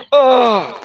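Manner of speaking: loud, high yelped "oh"; imitated cry. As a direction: cry out as if just hit from above. Imitates cry from below stairs.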